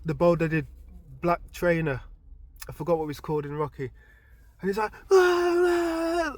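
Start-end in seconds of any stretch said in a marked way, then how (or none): 5.1-6.3 high, loud pleading wail; trainer's desperate plea to stop the fight; pleading cry. Retold or imitated. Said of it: "like that, pleading with everyone".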